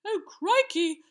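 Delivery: put-on high, loud boyish alarm; gulped alarm. A narrator's reading. Young knight sees the giant charging, alarmed. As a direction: squeak it out in dismay. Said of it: "gulped Sir Scallywag".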